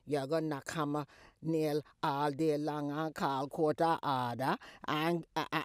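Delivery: normal talking voice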